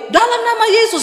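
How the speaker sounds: high, commanding cry of Jesus' name; forceful rebuke prayer. Retold or imitated. Preacher re-enacting a deliverance prayer, commanding.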